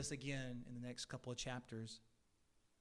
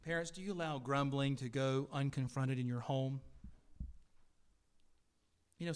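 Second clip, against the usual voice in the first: normal talking voice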